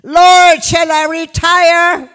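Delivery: full-voiced shout, high and slow; shouted prayer. Preacher retelling her shout to God at a conference, high loud shout. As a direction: cry it out across the hall. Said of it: "habe ich laut in den Raum geschrien".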